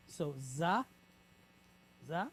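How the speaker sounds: za said slowly, open a; teacher sounding out za; syllable za. Shown as a demonstration. Teacher sounding out the syllable za for the class. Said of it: "we have the A sound".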